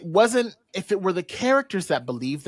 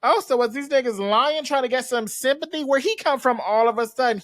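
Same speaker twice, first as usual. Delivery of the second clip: higher-pitched mock indignation; indignant suspicion; 7 semitones higher than usual. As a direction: question it like an affronted gossip. Voices public suspicious of Charles's return.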